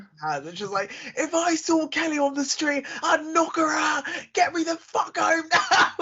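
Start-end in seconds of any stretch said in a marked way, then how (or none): 1.2-5.9 high, ranting Essex impression; hammed-up Essex outburst; exaggerated Essex accent. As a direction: put on a strong Essex accent, pitched way up. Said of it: "you're putting on a British accent".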